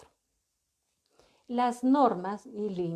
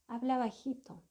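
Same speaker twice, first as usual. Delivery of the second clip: soft gentle instruction; 3 semitones higher, 6 dB quieter than usual. Shown as a demonstration. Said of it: "cómo lo podríamos formular en positivo".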